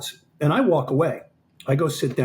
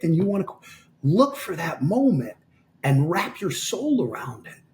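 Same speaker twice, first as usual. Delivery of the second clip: impassioned exhortation; 4 semitones higher than usual. Retold or imitated. Coach urging exhausted fighter to embrace it, intensely earnest. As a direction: urge them on with rising, stretched phrases. Coach's retold motivational speech, hands on shoulders.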